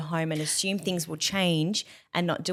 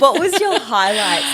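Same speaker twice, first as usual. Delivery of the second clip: asked through a real laugh, louder; giggling out the question; 6 semitones higher, 12 dB louder, slower than usual. Real, not acted. Laughing while asking the host.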